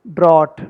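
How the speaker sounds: ड्रॉट with an AW vowel and no F; mistaken pronunciation. Shown as a demonstration. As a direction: say ड्रॉट like 'drawt'. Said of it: "पर ये ड्रॉट नहीं है". (ड्रॉट को 'ऑ' के साथ, बिना फ़ बोलें)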